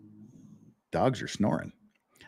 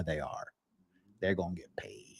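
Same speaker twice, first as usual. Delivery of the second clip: whisper; 8 semitones lower, 14 dB quieter than usual. Real, not acted. Sarcastic aside about lawsuit.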